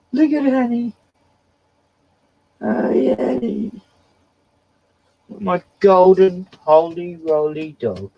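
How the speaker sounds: high, slow cooing to the dog; high doting pet voice; pet talk. Real, not acted. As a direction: dote on the golden dog.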